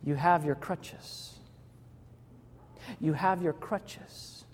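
soft, slow, hushed inner voice; whispered little voice. A narrator's reading. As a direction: breathe it gently like a small voice in her ear. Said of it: "a little voice whispered in her ear".

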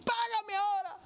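high, sharp demand for payment; harsh demand. Acted. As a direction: bark it out while grabbing him. Creditor chokes the debtor.

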